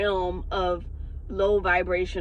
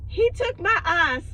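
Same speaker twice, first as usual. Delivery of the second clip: crackhead lady voice; 9 semitones higher, 4 dB louder than usual. Retold or imitated. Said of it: "this crackhead lady came in".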